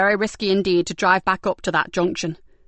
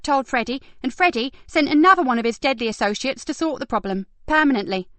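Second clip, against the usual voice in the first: put-on lightly higher young woman voice; brisk higher detective voice; Kat voice; 7 semitones higher than usual. A narrator's reading. Kat explaining the case.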